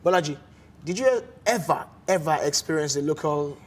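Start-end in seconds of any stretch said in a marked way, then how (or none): none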